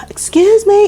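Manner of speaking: shouting far off, high and loud; retold scream. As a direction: call out across a field to strangers. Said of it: "So I started screaming".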